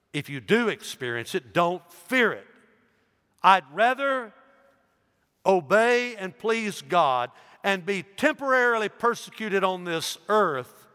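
loud, high exhortation; preaching at full force. Acted. Preacher urging church not to fear, loud. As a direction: urge them on boldly.

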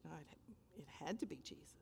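breathless whispered certainty; hushed, reverent whisper; awed whisper. Real, not acted. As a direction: whisper it in wonder. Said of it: "awed whisper after story".

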